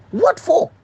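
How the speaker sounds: sharp, high, loud outraged question; a brother's outraged challenge to the police; indignant what for. Retold or imitated. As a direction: demand an answer, stunned and angry. Brother confronts confessing police.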